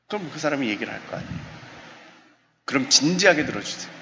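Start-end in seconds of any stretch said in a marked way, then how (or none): none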